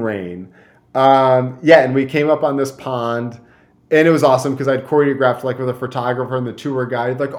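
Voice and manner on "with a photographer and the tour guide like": normal talking voice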